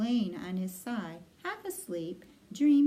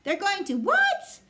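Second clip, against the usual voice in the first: high, loud shriek of disbelief; screamed; 12 semitones higher, 8 dB louder than usual. A narrator's reading. Pig learning of the plan, screaming in horror. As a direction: scream it at the top of the pig's voice. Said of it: "screamed Wilbur".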